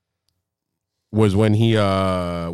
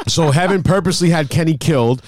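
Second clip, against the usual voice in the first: ordinary delivery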